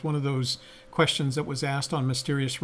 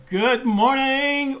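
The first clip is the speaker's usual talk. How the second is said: higher, louder, very slow drawn-out greeting; Good Morning Vietnam shout; 10 semitones higher, 8 dB louder, much slower than usual. Retold or imitated. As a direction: belt it like a radio DJ wake-up call. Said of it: "like Robin Williams".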